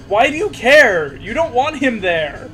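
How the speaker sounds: usual speaking voice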